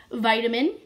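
usual speaking voice

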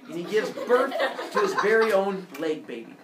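ordinary delivery, nothing marked

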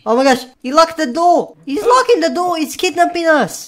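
high alarmed gasping talk; panicked. Real, not acted. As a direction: panic as the door locks. Customer locks the door.

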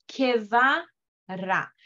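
ordinary talk, nothing marked

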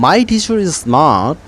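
teacher is with the r linked, slowly; linking r. Shown as a demonstration. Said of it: "এখানে কিন্তু my teacher is smartই বলতে হবে". (my teacher is smart, আর জুড়ে ধীরে)

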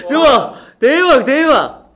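loud high laughing cheer; laughing mock wow. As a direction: ham up the amazement through laughter. (웃으며 과장된 감탄)

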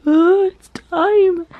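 high, loud, drawn-out mock excitement; excited dog's voice. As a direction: voice a thrilled pet, slow and high. Voicing the dog hearing gate.

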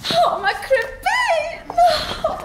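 very high, drawn-out squeal; gushing outcry. Acted. Woman throws herself at her lover, gushing squeal. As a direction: gush at high pitch.

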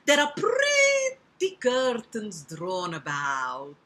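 slow, loud Italian spider; Italian accent. Acted. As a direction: entice with drawn-out Italian vowels. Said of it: "I can make him Italian".

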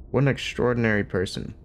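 ordinary delivery

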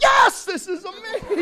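high, loud, slow laughing gush; giddy shouted yes through a laugh. Retold or imitated. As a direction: laugh out your delight. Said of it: "I'm like".

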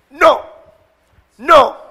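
high, very loud No; shouted refusal. Shown as a demonstration. Preacher interceding, shouting no at the enemy. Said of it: "a interceder".

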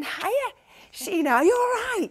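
high, loud Lancashire greeting; Lancashire accent. Retold or imitated. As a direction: imitate a chirpy, high-pitched Lancashire hello. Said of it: "in the Lancashire accent".

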